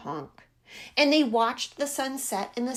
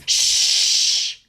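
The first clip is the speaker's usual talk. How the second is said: sharp unvoiced shh; loud shushing hiss; 9 dB louder than usual. A narrator's reading. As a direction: hush him hard, all breath. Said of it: "Goose hushed".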